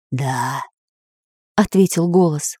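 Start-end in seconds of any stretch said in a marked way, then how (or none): 0.1-0.6 low, soft spirit reply; ghost voice. Acted. Spirit answers the artist, low soft ghost voice. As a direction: answer from beyond, low and hushed.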